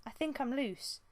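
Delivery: a strained apprentice tugging free; Squirrelpaw, lightly voiced. A narrator's reading.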